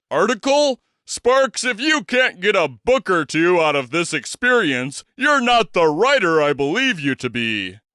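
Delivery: a hint of a booming big man, amused; lightly voiced big Texan. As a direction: start high with a jab, then hold court. Big Daddy's voice.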